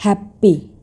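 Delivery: हैप्पी split into two beats, "hap" then "pi"; syllable by syllable. Shown as a demonstration. Teacher showing learners the beats of a word, syllable by syllable. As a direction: break हैप्पी into hap, pee with a gap. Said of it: "इसका हम लोग practice भी कर लेते हैं".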